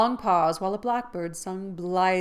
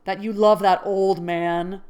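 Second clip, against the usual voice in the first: louder, slow, bitter; jealous accusation; 9 dB louder than usual. Acted. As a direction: spit out the accusation.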